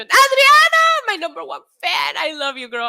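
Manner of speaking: very high, shouted delighted welcome; squealed hello that eases down; excited greeting. Real, not acted. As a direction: call out to a favorite fan, thrilled. Spots favorite fan joining chat.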